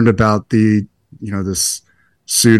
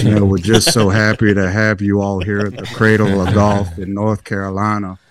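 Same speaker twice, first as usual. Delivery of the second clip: imitating the governor's slow Southern drawl; a drawn-out Southern welcome, Carolina style. Golfer imitating the governor welcoming players, Southern drawl. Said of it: "with his long drawl".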